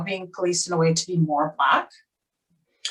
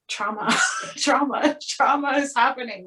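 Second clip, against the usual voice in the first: higher-pitched alarm call with a laugh; playful distress, pitched up; mock alarm; 6 semitones higher than usual. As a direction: sound a half-joking inner siren. Retold inner alarm at audition.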